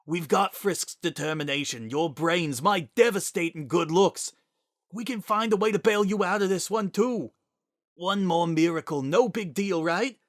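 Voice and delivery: high, fast, forced-cheerful pleading. Acted. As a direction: talk him round with frantic cheer. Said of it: "the look in his eyes turned pleading".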